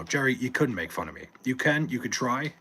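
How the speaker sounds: normal talking voice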